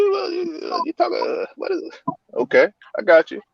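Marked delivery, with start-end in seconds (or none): 0.0-1.9 high, soft squeaky bafflement; confused mock voice. Imitating a confused outsider asking about Baltimore slang, high. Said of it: "They're like".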